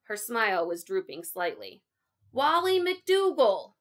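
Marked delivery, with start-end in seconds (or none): 2.3-3.7 put-on high, loud, drawn-out boy's outburst; too-loud blurt. A narrator's reading. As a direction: blurt your name out far too loudly. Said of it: "blurted out just a little too loudly".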